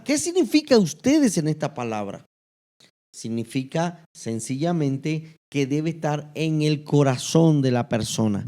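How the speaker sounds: ordinary talk, nothing marked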